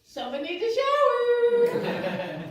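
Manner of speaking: slow mocking baby talk to the young boss. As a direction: coo at her like she's a toddler.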